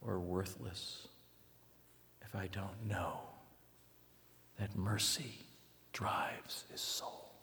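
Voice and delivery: slow, near-silent, fading to a whisper; hushed. Real, not acted. Preacher hushing the congregation on mercy. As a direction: pull the room in with a hush.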